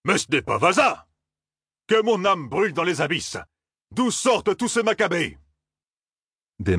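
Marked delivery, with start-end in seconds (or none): 0.0-5.4 high, shocked outcry; exclaiming. A narrator's reading. Captain finding the corpse, exclaiming in shock. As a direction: cry out in horror. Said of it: "s'écria".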